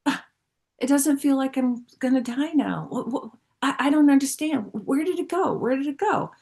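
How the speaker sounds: replaying the moment it all drained away; bewildered disbelief. Telling the group, high, lost, puzzled disbelief. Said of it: "And I'm like".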